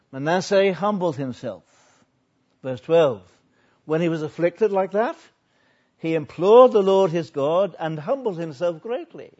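loud, emphatic sermon reading; ringing pulpit delivery; forceful preaching. Real, not acted. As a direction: proclaim the verse with force.

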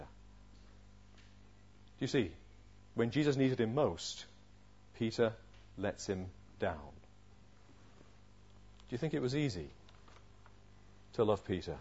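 low, very soft, grave delivery; subdued, solemn reflection; hushed preaching. Real, not acted. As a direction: let it sink in quietly, with long pauses.

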